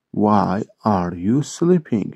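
"Why are you sleeping" slowly, word by word; slow model repeat. Shown as a demonstration. English teacher repeating a question for Turkish learners, slow model repeat. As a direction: stretch each word out for learners.

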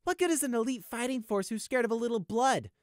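high, detached icy voice; cold. Acted. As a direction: answer with cold contempt. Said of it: "Prey responded coldly".

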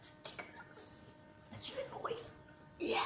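faint voiceless groan; disgusted whisper. Real, not acted. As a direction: choke the words out in a whisper. After tasting, gagging.

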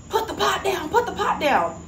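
high, urgent mock command, half laughing; mock officer yell. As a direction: bark the order like a panicky cop, played up. Officer orders woman drop pot.

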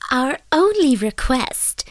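high, slow fairy voice; little man. A narrator's reading. Fairy begins his request to fisherman, high little voice. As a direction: announce it slowly in a high voice. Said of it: "said the little man".

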